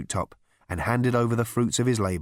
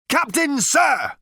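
high, loud military hail; crying out; 6 dB louder, slower than usual. A narrator's reading. Number Two reporting to his captain, crying out. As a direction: hail your commander. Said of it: "cried number two".

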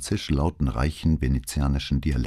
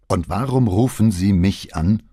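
louder, higher irritated question; testy and raised; openly annoyed; 4 semitones higher, 6 dB louder than usual. A narrator's reading. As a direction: demand it with undisguised irritation. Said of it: "seine Verärgerung zu verbergen".

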